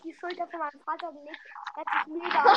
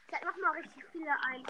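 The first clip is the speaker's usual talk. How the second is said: whispering; 8 dB quieter, faster than usual. Real, not acted. Aside while inviting guests.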